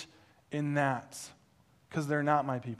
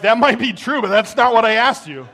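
much louder, higher barroom mock retort; 8 semitones higher, 16 dB louder than usual. Drunk neighbor loudly retorting to the misheard pastor. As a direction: fire it back, rowdy.

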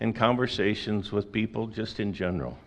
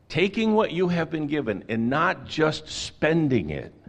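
raised, fervent preaching voice; 7 semitones higher, 4 dB louder than usual. Acted. Pastor preaching to the church, raised and fervent. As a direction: press the point home to the congregation.

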